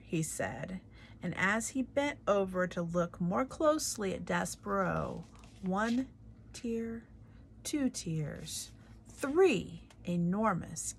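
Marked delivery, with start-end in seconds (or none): none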